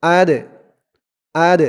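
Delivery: "আদ" with a bouncing release on the d; "আদ" ending in a short echoing bounce; qalqalah. Shown as a demonstration. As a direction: push off the final d of "আদ". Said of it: "ধাক্কা দিয়ে পড়তে হয়".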